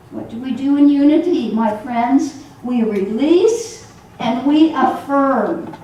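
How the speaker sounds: ordinary delivery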